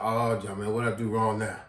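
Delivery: low, resigned mumble; reluctant whine. Retold or imitated. Student answering the guard, low and resigned. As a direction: grudgingly ask what you did wrong.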